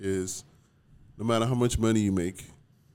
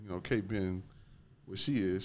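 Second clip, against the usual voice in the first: ordinary delivery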